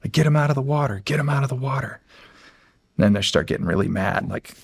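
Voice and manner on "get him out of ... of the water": mild mock yell. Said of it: "the crowd starts yelling".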